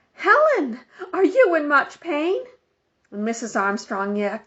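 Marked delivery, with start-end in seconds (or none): 0.1-2.4 high, loud call across the yard. Acted. Neighbor woman calling to injured Helen, high and loud. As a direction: call out to her from a distance. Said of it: "One of the neighbor women called out".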